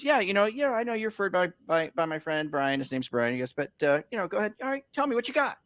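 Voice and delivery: mimicking the homeowner up high; high-pitched homeowner voice. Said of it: "the guy goes, he goes".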